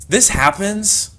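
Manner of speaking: slow, high, loud drawn-out announcement; dramatic. Acted.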